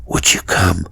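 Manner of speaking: low, subdued near-whisper; a frightened man whispering at night; almost a whisper. A narrator's reading. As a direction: breathe the question under your breath. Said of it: "in a voice low and subdued, almost a whisper".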